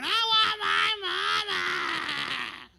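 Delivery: put-on possessed woman speaking as a little kid. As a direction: cry for your mama like a small child. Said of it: "Sounded like a little kid".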